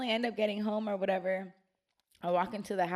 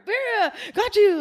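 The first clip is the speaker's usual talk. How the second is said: much higher, louder, laughing shout; gleeful mom gloating; 13 dB louder, slower than usual. Retold or imitated. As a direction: crow in triumph through laughter. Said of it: "she was like".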